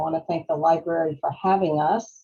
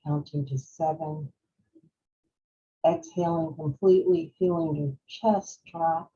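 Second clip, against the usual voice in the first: guided breathing meditation; 4 dB quieter than usual. Real, not acted. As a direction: lead them gently through each breath, unhurried. Leads a breathing exercise.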